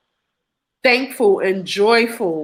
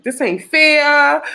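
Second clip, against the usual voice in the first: higher, drawn-out protest; mad, emotional; 5 semitones higher, 4 dB louder, slower than usual. Retold or imitated. Patient refused by her doctor, upset drawn-out protest. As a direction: stretch out an indignant complaint on "fair". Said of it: "Now I'm just mad, emotional".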